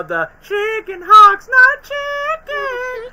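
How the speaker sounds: very high, loud, very slow; cartoon voice. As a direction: screech it like a cartoon hawk. Acting cartoon line.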